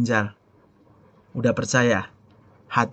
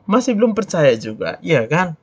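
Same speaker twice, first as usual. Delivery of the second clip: ordinary talk, nothing marked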